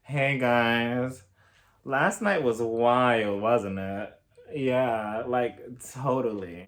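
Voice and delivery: imitating Kathy. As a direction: drawl it out like a dreamy partygoer. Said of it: "It's just like".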